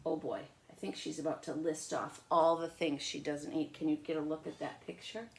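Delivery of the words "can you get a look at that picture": plain reading voice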